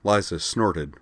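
usual speaking voice

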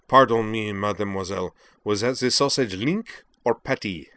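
put-on hammy waiter French accent; overdone French accent, posing as a waiter; exaggerated French accent. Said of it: "adopting a ridiculously exaggerated French accent".

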